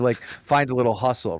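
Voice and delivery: ordinary talk, nothing marked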